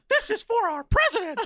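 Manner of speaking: very high mock-French voice; squeaky, laughing fake French accent; French accent attempt. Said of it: "I can't do a French accent".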